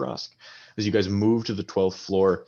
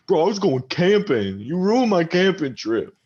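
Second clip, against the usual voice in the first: put-on high, slurring drunk arguing with his buddy; drunk quarrel; 10 semitones higher, 5 dB louder than usual. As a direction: snap at your drunk friend to calm down. Drunk Jacob complains.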